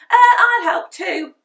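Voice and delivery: high, loud eager offer; eager Henry. A narrator's reading. Henry volunteering for the crisps, loud and eager. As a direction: jump in like a greedy boy. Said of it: "said Henry".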